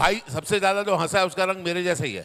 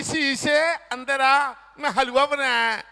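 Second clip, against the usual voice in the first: aunty voice; 6 semitones higher, 4 dB louder, slower than usual. Retold or imitated. Aunty inviting him for halwa.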